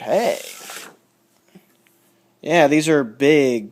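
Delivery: usual speaking voice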